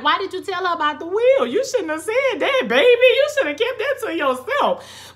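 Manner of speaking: high, loud mock scolding with a laugh; exasperated scolding. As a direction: scold him like a fed-up auntie, laughing. Host scolds Bishop in mock voice.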